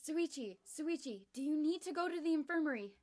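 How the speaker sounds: high, loud worried call; yelling. Acted. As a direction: call out to him in alarm. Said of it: "I heard someone yell".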